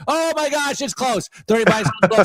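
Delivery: high, urgent cries of alarm; excited dismay. Real, not acted. Trader watching the price slip, high and alarmed. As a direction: react as the price slips away.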